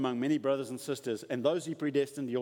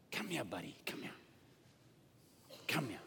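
put-on soft and low, coaxing a child closer; tender fatherly call; 8 semitones lower, 13 dB quieter than usual. Father softly calling his beloved son to come close, tender. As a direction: gently call your little boy over.

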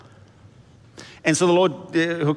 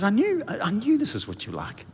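high, pouting complaint that drops away soft; a sulking prophet grumbling at God; whiny sulking Jonah; 7 semitones higher, 4 dB quieter than usual. Retold or imitated. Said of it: "He says".

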